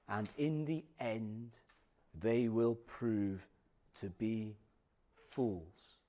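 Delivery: low, slow reading with heavy pauses; solemn scripture reading. Acted. Preacher reading Jeremiah to the congregation, low and solemn. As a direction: read it gravely, phrase by phrase.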